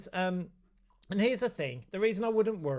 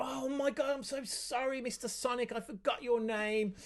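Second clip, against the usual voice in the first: playful apology to Sonic; 7 semitones higher than usual. Shown as a demonstration. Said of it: "so try that".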